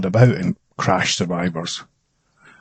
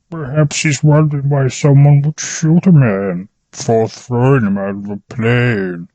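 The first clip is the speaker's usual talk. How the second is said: muffled voice; 4 semitones higher than usual. Acted. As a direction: speak through a hand over the mouth.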